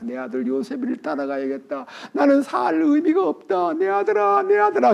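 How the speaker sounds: 점점 높아지는 아버지의 연기된 통곡; 아들을 잃은 아버지처럼 울부짖되 과하지 않게; wailing father. Acted. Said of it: "막 대성통곡하면서". (아들 잃은 아버지가 울부짖는 가벼운 통곡)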